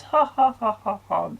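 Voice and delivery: high, fake ha-ha-ha; mock laugh. Reviewer deriding needless gags in a dark film, mock laughing. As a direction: force out a silly laugh on a high note. Mocks the film's misplaced comedy.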